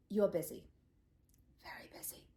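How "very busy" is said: whispered final jab; icy whisper. Acted. Banning him from family dinner, a cold pointed whisper. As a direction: breathe it out voiceless and cold.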